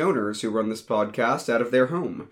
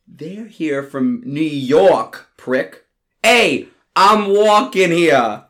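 slow, hammed-up New York bit; New York accent; 3 semitones higher, 5 dB louder, slower than usual. Host greeting New Yorker, hammed-up New York accent. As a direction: ham up the cabbie. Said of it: "then continues".

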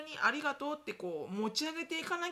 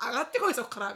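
louder gruff dare; tough taunt; 7 dB louder, faster than usual. Retold or imitated. Strict parent dares the child, louder. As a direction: challenge them to climb back. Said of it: "みたいな".

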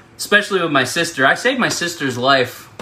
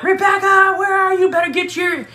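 mom calling out. Retold or imitated. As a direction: call out for the missing daughter. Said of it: "She's like".

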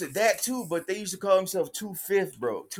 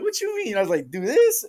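high, innocent questions, the last one louder; mock innocence, pitched up; playing dumb; 6 semitones higher, 6 dB louder than usual. Teasing the car crew who mistook him, feigned innocence.

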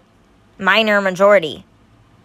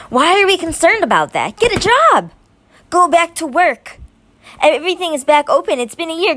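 exasperated, pitch climbing high; worked up; 8 semitones higher than usual. Real, not acted. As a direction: let the frustration boil over. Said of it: "I'm getting a little worked up".

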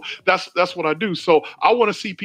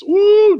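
high loud drawn-out whoop; exclaimed "ooh"; 8 dB louder than usual. Real, not acted. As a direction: let out a long high "ooh". Reacting to blaming among believers.

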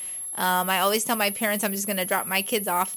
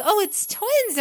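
surprised retold; 12 semitones higher, 3 dB louder than usual. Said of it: "we was like".

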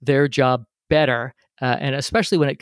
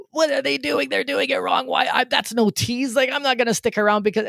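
mock-yell at the radio like a frustrated pro; 9 semitones higher than usual. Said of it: "I'm yelling at the radio".

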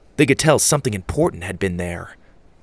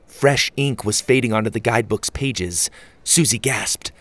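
plain reading voice

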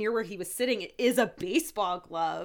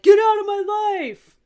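much higher, louder yelled order; furious shout; 12 semitones higher, 11 dB louder, slower than usual. Retold or imitated. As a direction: scream to drive him away. Host screaming at caller.

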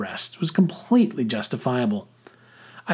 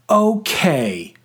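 high, defiant last word; half-yell it to finish the argument; mild yell; 7 semitones higher, 4 dB louder than usual. A narrator's reading. Boy determined to get the last word, mild yell. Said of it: "I yelled".

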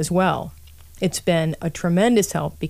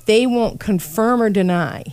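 ordinary delivery

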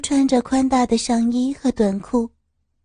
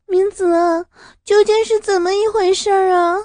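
anxious confused plea; 8 semitones higher, 4 dB louder than usual. Acted. Confused frightened girl.

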